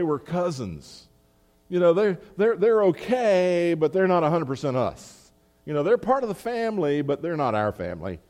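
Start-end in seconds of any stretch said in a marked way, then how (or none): 1.7-8.2 higher, louder, sniffy condescending take on outsiders; mock snobbish insiders. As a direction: lightly voice the smug insiders looking down. Mimicking the Judaizers' view of Gentiles.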